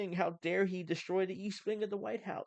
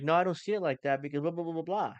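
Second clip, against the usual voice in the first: quicker, brisk everyday delivery; fast and punchy, a bit louder; normal fast talk; 4 dB louder than usual. Shown as a demonstration. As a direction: rattle it off like a normal person. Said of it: "talk like a normal person".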